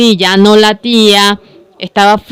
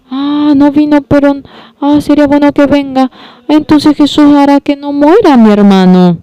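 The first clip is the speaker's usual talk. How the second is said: hopeful sister; 8 semitones higher than usual. Acted. Marta hoping Jesus comes.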